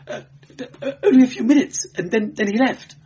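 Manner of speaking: high, fast nervous stammer; shrill nerves. A narrator's reading. Clerk questioned about his visitor, shrill and stammering. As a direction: blurt it out shrilly between stumbles. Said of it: "clerk's voice was shrill with taut nerves".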